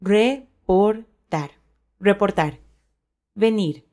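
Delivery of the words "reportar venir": normal talking voice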